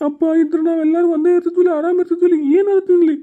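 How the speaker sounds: high-pitched crying complaint; tearful lament. Acted. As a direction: wail about being abandoned. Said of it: "ಅಳ್ಬೇಡ, ಓ, ಅಳ್ಬೇಡ".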